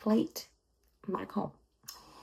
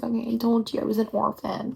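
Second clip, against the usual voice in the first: normal talking voice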